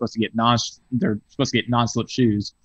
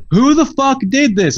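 high furious yell, slow and much louder; 6 dB louder than usual. Retold or imitated. Retelling yelling at the cook who mauled the burger. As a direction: scream it at the grill cook. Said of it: "And I, like, yelled".

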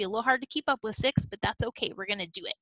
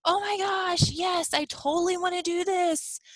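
high, bright burst of mock excitement, up from her usual voice; thrilled new recruit; 9 semitones higher than usual. Host mimicking an eager recruit saying yes, excited. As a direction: squeal it like someone jumping up and down. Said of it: "jumps up and down and says".

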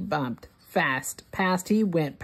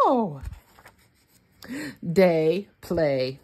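ordinary delivery, nothing marked

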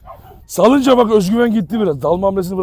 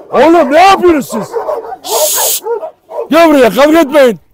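high, loud scolding at the dogs; scolding shout; 9 semitones higher, 5 dB louder than usual. Real, not acted. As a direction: stop the fight with a yell. Stopping dogs from fighting. (kavgayı durdurmak için bağırma)

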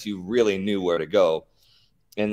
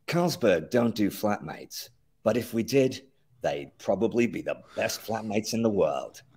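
Carlsberg voice; 3 dB quieter than usual. Shown as a demonstration. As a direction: savour each word like a premium beer ad. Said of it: "Hit me with that one more time".

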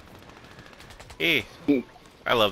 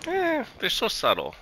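mock dismissive; 12 semitones higher than usual. High slow mocking remark.

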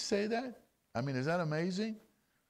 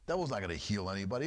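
rushed; 6 semitones lower, much faster than usual. Real, not acted. Preacher rushes through warning.

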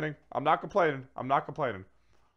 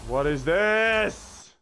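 drawn-out surprise; 7 semitones higher, 6 dB louder, much slower than usual. Real, not acted. Strange card revealed.

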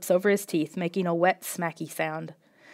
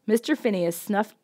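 ordinary delivery, nothing marked

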